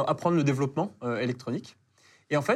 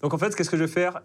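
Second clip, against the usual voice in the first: normal talking voice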